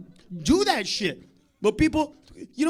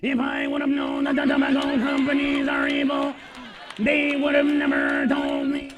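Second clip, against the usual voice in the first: mock sick smoker. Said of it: "you're gonna be here talking about".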